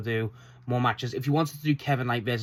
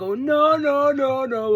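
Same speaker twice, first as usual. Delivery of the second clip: high, loud imitation of a wrestler; Tekla; 14 semitones higher, 6 dB louder, much slower than usual. Fan mimics a wrestler's protest, high and loud impression. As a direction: draw out the noes in a hammed-up promo voice. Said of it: "She's like".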